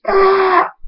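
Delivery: short, very high scream; high shriek before fainting. A narrator's reading. Hunter losing his other wing, screaming. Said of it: "Hunter screams".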